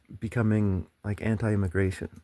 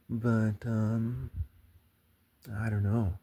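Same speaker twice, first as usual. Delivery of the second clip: normal talking voice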